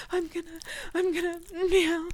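ordinary talk, nothing marked

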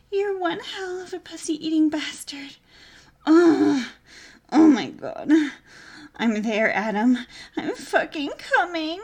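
climax shout. Acted. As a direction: let it all out loud.

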